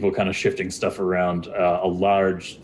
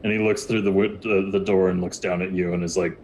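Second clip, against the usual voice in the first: ordinary talk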